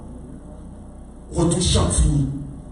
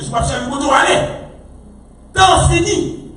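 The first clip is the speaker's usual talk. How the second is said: high, raised warning cry; urgent preaching; 4 semitones higher than usual. Real, not acted. Preacher warning church that time is finished, urgent cry. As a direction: warn them that time is up. Preaching about end times.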